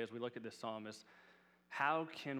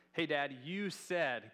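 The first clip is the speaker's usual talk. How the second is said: put-on slow, high, pleading little girl voice, a bit louder; imitating his little daughter; 4 semitones higher, 7 dB louder, slower than usual. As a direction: playfully mimic a daughter reminding her dad of his promise. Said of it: "That's how she would say it".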